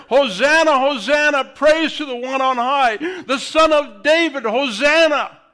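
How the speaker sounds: loud, high festive shout; preacher shouting Hosanna as the crowd; crowd's joyful cry. Retold or imitated. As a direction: cheer it like the palm crowd.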